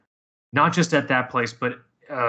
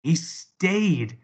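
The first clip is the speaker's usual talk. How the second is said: raised pitch on a stunned repeat; lingering disbelief; 5 semitones higher than usual. Real, not acted. Repeating to cohost that he stayed, still incredulous. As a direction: let the amazement sink in, pitched up.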